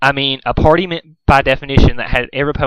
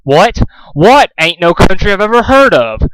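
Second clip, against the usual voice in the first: higher, louder, disbelieving yell; angry interrogation; 6 semitones higher, 5 dB louder than usual. A narrator's reading. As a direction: bark the questions at him. Pinkamena interrogating furiously.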